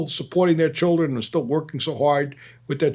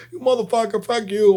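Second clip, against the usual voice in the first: high mock curse-out; retold cursing; 8 semitones higher than usual. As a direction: swear at him, raising the pitch. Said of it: "screaming at me, yelling at me".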